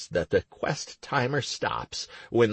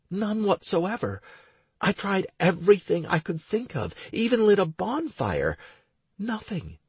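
lightly raised woman's voice; young woman voice; 11 semitones higher than usual. Acted. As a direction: voice Stacy a little higher. Stacy answers.

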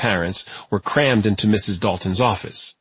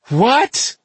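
much higher, indignant cry of disbelief; cried out; 4 dB louder than usual. A narrator's reading. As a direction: shoot up in shock at the ruling. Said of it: "he cried".

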